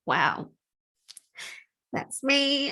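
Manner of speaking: choked-up recognition, pitch jumping high on me; real tears breaking through; tearful wow, voice leaping up on that's me. Real, not acted. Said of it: "I don't know why I'm crying".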